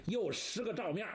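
plain reading voice

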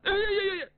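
hammed-up crying aiyayaya; fake wailing sob. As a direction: wail like a spoiled girl to guilt him. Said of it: "你别哭".

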